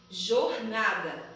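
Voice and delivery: normal talking voice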